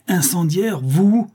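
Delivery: high, loud cries of disowning; mother disowning her daughter in a rage; furious outburst. A narrator's reading. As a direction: hurl it at the guilty daughter. Countess disowns her arsonist daughter.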